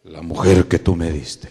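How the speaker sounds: low, booming Adam voice; loud, deep accusing answer; Adam answering God. Acted. Adam blames the woman to God, low and loud. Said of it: "¿Pero qué le dijo?".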